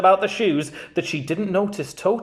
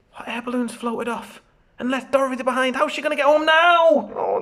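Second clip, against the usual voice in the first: plain reading voice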